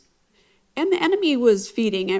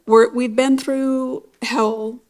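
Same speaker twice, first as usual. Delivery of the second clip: drawn out and louder, worn down; pleading, slow and tearful; 3 semitones higher, 5 dB louder, slower than usual. Retold or imitated. Wife to husband, tired of the fight: slow tearful plea. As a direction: beg him, slow and aching.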